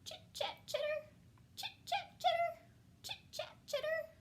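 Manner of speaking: very high, squeaky bat chatter. Acted. Parent voicing the bats for a child, high squeaky chatter.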